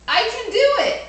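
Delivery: I can do it, cheered high and loud; excited. Shown as a demonstration. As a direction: shout it out like a proud kid. Repeated answer, second take.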